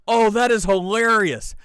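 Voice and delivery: loud, high-pitched delight; delighted amazement. Real, not acted. Host hearing of crane heist, loud delight. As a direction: burst out, tickled and amazed. Reacts to thieves using a crane.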